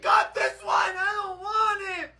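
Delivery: whiny fake cry. Whining over a chicken.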